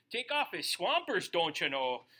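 mock the folksy up-north way of talking; a joking regional Minnesota accent; local up-north accent. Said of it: "Or up here".

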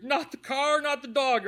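high, loud mock wail; hammed-up movie viewer's protest; mock distress. Viewer reacting to a favorite movie's sad scene, mock distress.

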